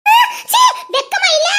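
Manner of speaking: high, loud cry of disgust; disgusted scolding. Acted. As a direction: shame them, pitch leaping up. (அருவருப்புடன் கண்டிப்பு)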